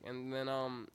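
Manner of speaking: ordinary talk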